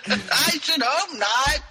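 half-hearted yell, stretched slow and high; mock yell. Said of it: "yells Jane".

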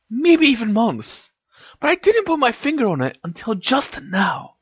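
high-pitched excited revelation; exclaiming. A narrator's reading. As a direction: exclaim it high, building your case against him. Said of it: "exclaimed Hermione".

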